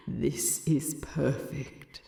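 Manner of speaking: slow hushed whisper to himself; whispered thought. Acted. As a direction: breathe it like a private thought. Thorin's inner thought.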